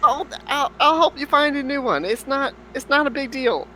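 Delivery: high, pleading character voice; nervous apology. Acted. Adventurer pleading with the angry farmer, high and flustered. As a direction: beg forgiveness in a squeaky panic.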